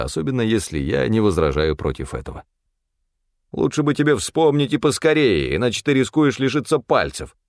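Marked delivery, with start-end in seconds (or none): 3.5-7.4 high-pitched grumbling character; grumbled threat. A narrator's reading. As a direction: threaten the servant in a high, peevish voice. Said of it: "пробубнил Стефан". (ворчливая угроза высоким голосом)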